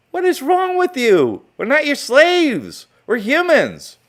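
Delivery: high, loud, indignant yell; real outraged shouting. Real, not acted. Outraged rant about cages.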